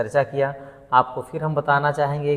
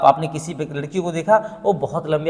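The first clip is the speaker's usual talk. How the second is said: usual speaking voice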